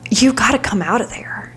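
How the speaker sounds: urgent whisper to yourself in the mirror; whispered urging. Retold or imitated. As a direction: coax her out in a hushed whisper. Urging her inner self in mirror.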